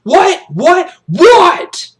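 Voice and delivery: rapid-fire shouted whats; high, loud drill-sergeant demand, building; storming in. Acted. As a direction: bark each word in the boy's face. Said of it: "So he storms up to him".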